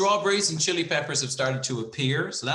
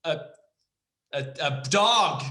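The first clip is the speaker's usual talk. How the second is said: higher, louder mock complaint at someone eating; annoyed snap; 6 semitones higher, 5 dB louder than usual. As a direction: scold them for chewing like a dog. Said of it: "Would you be like".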